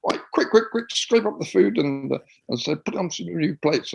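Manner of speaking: high, loud hurried commands; urgent orders. Retold or imitated. Performer hurrying the kitchen staff, urgent high orders. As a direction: rush the staff along.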